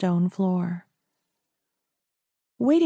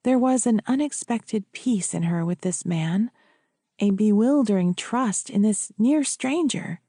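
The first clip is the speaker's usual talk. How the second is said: ordinary delivery, nothing marked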